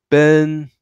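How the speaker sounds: "Ben" held high and loud; high level tone. Shown as a demonstration. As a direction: pitch "Ben" high and keep it even. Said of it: "Ben is also very high volume".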